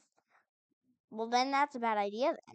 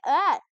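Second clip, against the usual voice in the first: startled yelp; 3 semitones higher, 11 dB louder than usual. Real, not acted. Player yelping when a creature appears, startled. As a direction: jump at the fright with a yelp.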